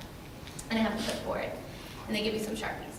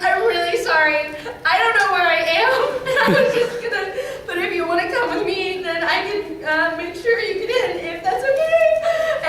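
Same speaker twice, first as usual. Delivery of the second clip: near tears; 8 semitones higher, 8 dB louder than usual. Retold or imitated. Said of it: "trying really hard not to cry".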